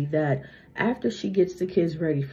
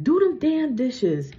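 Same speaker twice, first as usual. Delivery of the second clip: bossy mom; 7 semitones higher, slower than usual. Retold or imitated. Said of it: "your mom's like".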